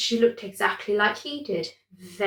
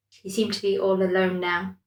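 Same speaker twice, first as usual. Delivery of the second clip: usual speaking voice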